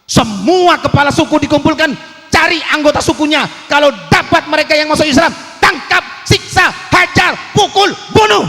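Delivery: high, loud, fast barked commands; a tyrant roaring out a decree; shouted orders. Acted. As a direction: bellow the order to hunt them down. Voicing the chiefs' brutal orders.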